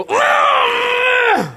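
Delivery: high, strangled cartoon groan of fury; mock angry groan. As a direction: choke out a comic furious grunt. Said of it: "you know you mad when you go".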